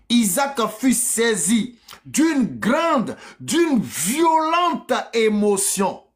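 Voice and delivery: drawn-out, high, solemn proclamation; dramatic scripture reading. A narrator's reading. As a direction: stretch the weighty words slowly and dramatically.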